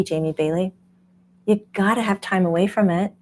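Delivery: normal talking voice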